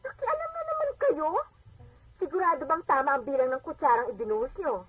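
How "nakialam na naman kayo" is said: sharp high rebuke; irritated accusation. Acted. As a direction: catch her mother meddling again. Daughter irritated at mother. (inis na paninisi)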